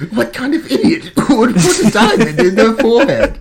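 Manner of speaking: laughing disbelief at a forehead diamond. Real, not acted. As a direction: crack up mid-rant. Laughs through his mock outrage.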